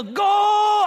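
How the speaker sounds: long, high, full-out holler; yelled cheer. Retold or imitated. Dad yelling at son on goal line, yelled cheer. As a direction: bellow it from the bleachers, drawn out. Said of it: "And I'll yell".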